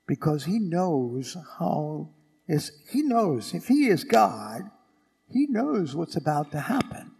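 ordinary talk

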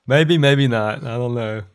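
usual speaking voice

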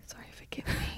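hushed whispered apology; whispering. Real, not acted. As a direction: whisper it, sheepish. Hushed apology after startling cohost.

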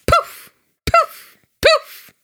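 very high, loud poof; high squeaky poof. Acted. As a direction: squeak poof like a magic trick. Mocking kittens appearing.

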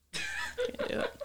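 squeaky high laughing, very slow; cracking up mid-line. Real, not acted. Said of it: "laughing through it".

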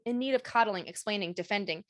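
normal talking voice

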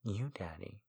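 whispered reply; soft whisper. A narrator's reading. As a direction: breathe it softly. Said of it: "I replied".

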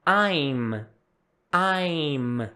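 "I'm" drawn out, closing on a silent-vowel m; correct I'M. Shown as a demonstration. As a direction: say "I'm" ending with lips shut on m. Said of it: "esse som de M, ele é mudo no final". ("I'm" com m mudo no final)

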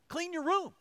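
high, sharp mock-parent command to the kids; stern parent order. Dad telling the kids to clean their room, mock stern. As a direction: snap it like a fed-up dad.

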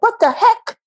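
a sudden, high squeak of surprise; startled what the heck. Retold or imitated. You, startled by spirit's advice, high and disbelieving.

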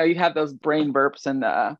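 usual speaking voice

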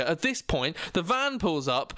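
ordinary talk, nothing marked

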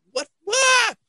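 high-pitched, incredulous double take; mock disbelief. Mimics shocked reaction to the trouble.

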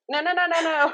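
playful high-pitched refusal of being a guru; emphatic refusal. Retold or imitated. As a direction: wave off the idea with a run of high nos.

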